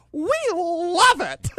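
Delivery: slow, high, gushing "we love it". Retold or imitated. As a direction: gush with delight. Retold reply in elevator.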